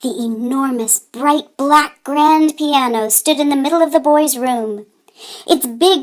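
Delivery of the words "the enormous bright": ordinary delivery, nothing marked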